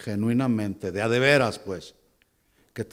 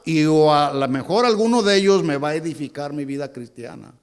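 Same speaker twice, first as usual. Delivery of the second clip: acted tired excuse, pitched higher and louder; excuse-making churchgoer; 9 semitones higher, 8 dB louder than usual. Preacher mimics a tired member excusing himself, higher and louder. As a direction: whine the excuse of someone who skips church. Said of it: "Y el argumento es".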